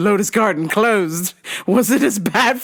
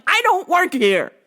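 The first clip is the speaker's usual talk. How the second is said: high-pitched exasperated protest; mock-indignant, pitch jumping way up; 10 semitones higher than usual. Customer answering shopper a second time, high exasperated protest. Second reply after repeated request.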